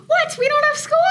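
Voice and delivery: high, shocked gasp of disbelief; surprised gasp. Acted. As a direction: gasp as if stunned. Gasp at news of no school.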